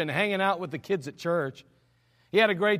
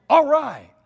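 put-on loud, high childlike cheer; excited kids imitation; 5 semitones higher, 9 dB louder than usual. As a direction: shout it like kids thrilled about an outing. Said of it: "They act like a bunch of kids".

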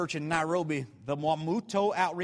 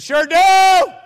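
shouted "Sure do", much louder and higher; intimidating shout; 12 semitones higher, 16 dB louder than usual. Retold or imitated. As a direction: blast it to intimidate. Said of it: "when you talk loud".

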